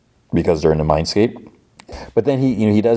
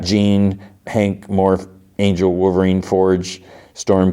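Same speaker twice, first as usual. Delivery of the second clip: plain reading voice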